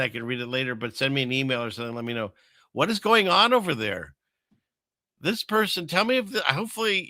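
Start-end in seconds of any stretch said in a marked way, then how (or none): 2.7-7.1 excited. Real, not acted. Reacting to shocking Australia clip.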